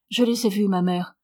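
ordinary delivery, nothing marked

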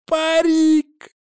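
high, gleeful drawn-out yell; exclaiming. Acted. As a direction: shout it out in glee. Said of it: "воскликнул он".

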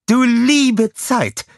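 high, slow theatrical dismay; villainess mock dismay. A narrator's reading. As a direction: ham up fake concern. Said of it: "fuhr Scarlett fort". (gespielt entsetzt, hoch und langsam)

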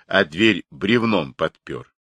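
plain reading voice

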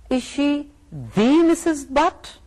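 the stretched as "thee", high and slow; stress on the. Shown as a demonstration. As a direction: lean on the, as if only one exists. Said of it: "if somebody asks you".

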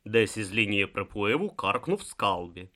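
ordinary delivery, nothing marked

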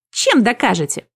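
loud little squeak of challenge. A narrator's reading. Little man demands proof from the demons, squeaking. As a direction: squeak it out, mildly alarmed. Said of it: "пискнул человечек".

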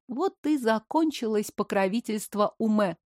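plain reading voice

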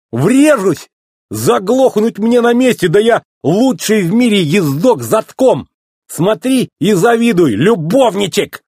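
high, cocky hollering; excited high-pitched bragging; boastful yell. A narrator's reading. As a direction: whoop and brag to your pal. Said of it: "завопил мэтр и рванул назад".

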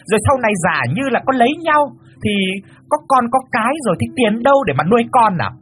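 high, fast, shrill-free ranting mother; loud fussing. Acted. Mother berates poor suitor about money, high fast rant. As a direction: rant and hound him for an answer. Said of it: "bù lu bù loa".

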